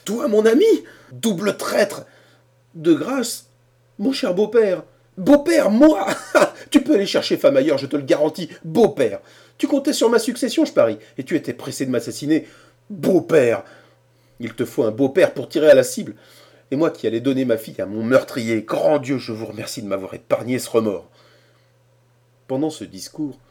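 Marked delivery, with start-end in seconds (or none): 0.0-6.1 high, loud scornful outrage; indignant outburst. A narrator's reading. The furious father-in-law mocks Harrison's plea. 6.1-6.7 bitter mocking laugh; a short contemptuous laugh; scornful laugh. A narrator's reading. The old man laughs at Harrison's claim. 6.7-21.1 loud, high, fast scolding; furious tirade. A narrator's reading. Cornelius scolds Harrison for shooting him.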